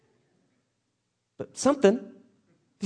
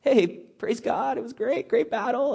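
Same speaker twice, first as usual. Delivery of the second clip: nervous fake cheer; 6 semitones higher, 3 dB quieter, slower than usual.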